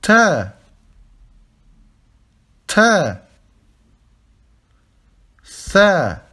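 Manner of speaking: Ta and Tha said loud and distinct; Arabic letter names. Shown as a demonstration. Teacher reading letters aloud for students, firmly. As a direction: model each letter for repetition. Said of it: "lire chaque lettre".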